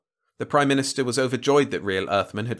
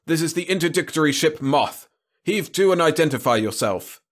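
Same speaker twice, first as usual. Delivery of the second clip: high, raised patrol officer's voice; blasting radio order; 7 semitones higher, 4 dB louder than usual. A narrator's reading. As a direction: order a ship to halt by radio. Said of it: "the radio blasted".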